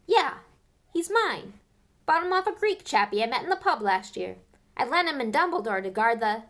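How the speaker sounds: high, loud proud half-giant; proud Hagrid. Acted. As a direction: rattle on proudly about his dog. Narrator voices Hagrid.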